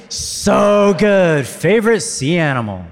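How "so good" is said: big loud, high group shout; yelled from the gut. Real, not acted. Preacher leading the congregation's yelled response, loud. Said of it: "you guys yell out the choice".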